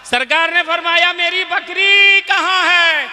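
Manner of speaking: high, loud commanding question; rousing preacher's call; preaching voice. Acted. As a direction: boom it out like a sermon climax. Preaching at full force.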